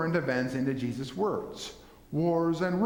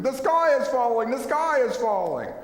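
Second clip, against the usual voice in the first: high, loud panicky cry of doom; panicked scream; 8 semitones higher, 5 dB louder than usual. Retold or imitated. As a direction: shriek it like Chicken Little in a panic. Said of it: "running around screaming".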